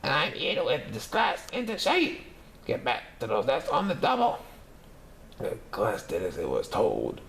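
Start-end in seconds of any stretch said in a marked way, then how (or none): 0.0-4.4 high scratchy barking orders; high, scratchy drill-sergeant teacher; strict witchy teacher. Acted. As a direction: whip the class into shape. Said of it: "said Miss Swamp".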